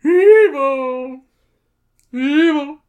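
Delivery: put-on high, loud old hero yell; Mermaid Man. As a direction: shout it like a crazy old superhero. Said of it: "I'm trying to do Mermaid Man".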